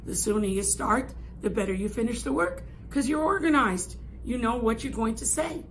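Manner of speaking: ordinary talk, nothing marked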